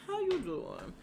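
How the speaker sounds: high-pitched imitation of a greeting; Wendy Williams-like. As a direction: put on a high, exaggerated catchphrase voice. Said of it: "she's giving very much".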